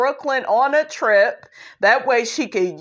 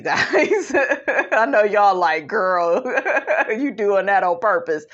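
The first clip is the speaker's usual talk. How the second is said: amused, laughing between phrases; tickled by your own slip; real giggling; 2 semitones lower than usual. Real, not acted. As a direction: chuckle through the words at yourself. Laughing at her own repeated mistake.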